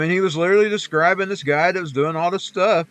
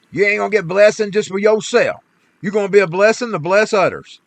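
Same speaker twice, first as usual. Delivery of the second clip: louder, driving the point home; repeating the lesson with raised voice; preaching emphasis; 4 semitones higher, 6 dB louder than usual. Real, not acted. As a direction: preach it to the congregation.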